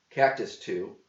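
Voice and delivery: plain reading voice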